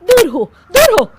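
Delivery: loud, high recoil; disgusted shout. Acted. Neighbour shoving the drunk bride away, disgusted. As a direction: push her away in disgust.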